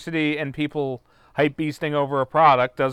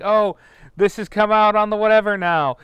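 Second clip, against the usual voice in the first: mock excitement; 8 semitones higher, 6 dB louder than usual. Mocking a hyped release announcement.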